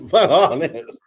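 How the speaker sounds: amused repeat of the refusal; laughing retort. Retold or imitated. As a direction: repeat "Fuck all this" while cracking up. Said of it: "I'm like".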